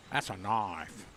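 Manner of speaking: put-on a light Australian accent, proud punch on "That's"; Crocodile Dundee quote. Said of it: "Okay, Crocodile Dundee".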